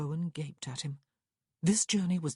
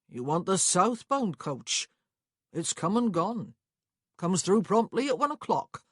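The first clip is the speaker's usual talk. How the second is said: louder country clerk voice, a little higher; unbothered clerk, raised voice; matter-of-fact clerk; 4 semitones higher, 6 dB louder than usual. Acted. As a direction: play a clerk who knows the timetable by heart.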